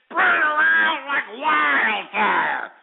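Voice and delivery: high, slow, drawn-out holler; rallying shout. Real, not acted. Host urging listeners to share the broadcast, rallying shout. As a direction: holler it out, stretching every word.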